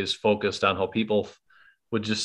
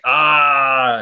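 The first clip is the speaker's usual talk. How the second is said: loud drawn-out excited cry; imitated excited exclamation; retold excitement; 5 semitones higher, 6 dB louder than usual. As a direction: cry out like a thrilled viewer. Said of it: "I was super excited".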